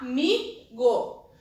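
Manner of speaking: amigo drawn out slowly. Shown as a demonstration. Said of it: "por exemplo ... separar em sílabas".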